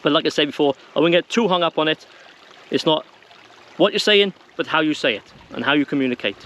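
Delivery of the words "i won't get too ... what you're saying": ordinary talk, nothing marked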